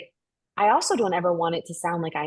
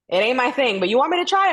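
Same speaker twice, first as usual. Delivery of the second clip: playful; 6 semitones higher, 3 dB louder, faster than usual. Retold or imitated. Retold playful offer to agent.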